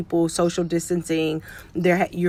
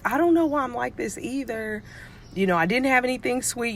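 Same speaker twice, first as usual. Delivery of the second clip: higher-pitched retold kid's protest; imitating puzzled child; 6 semitones higher than usual. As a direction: lightly voice a child who is baffled by herself. Voices her puzzled child.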